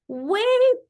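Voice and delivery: high, loud bellow. A narrator's reading. Mister Brown bellowing at the departing bus, high loud bellow. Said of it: "bellowed Mister Brown".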